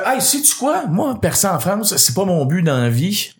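high-pitched, drawn-out indignant comeback; acted-out defiant outburst; indignant retort. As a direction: push it up high and slow, fed up.

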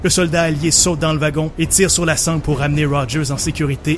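narrating an action scene over music; dramatic story narration. Acted. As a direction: tell it like a movie trailer. Narrates his version of scene.

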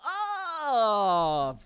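long loud falling "oh"; exasperated wail. Acted. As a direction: howl in exasperation. Exasperated outburst about relationship.

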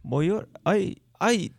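put-on a light Acadian accent, playful; hammed-up southern Acadian greeting. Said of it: "acadien du Sud".

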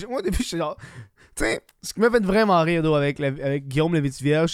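usual speaking voice

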